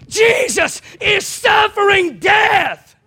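high, loud and slow; slow preaching shout. Real, not acted. Preacher describing the crucifixion, slow loud high cry. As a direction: stretch each word out in a full cry.

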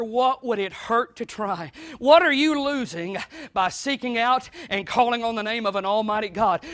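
ordinary delivery

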